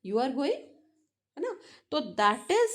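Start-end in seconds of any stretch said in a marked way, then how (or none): 0.1-0.6 "You are going" turned into a question by a rise on going; statement wording asked with an upturn on "going"; rising question intonation. Shown as a demonstration. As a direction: let "going" climb at the end. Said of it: "आपने इसको rising pitch पे बोला है".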